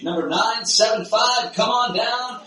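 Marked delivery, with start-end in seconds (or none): none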